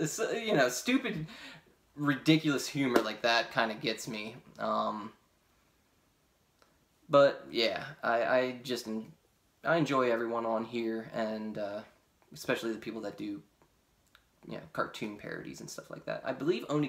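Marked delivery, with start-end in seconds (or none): none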